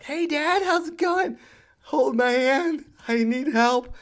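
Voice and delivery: put-on high, bright kid voice asking for help; little girl catching up; chirpy daughter.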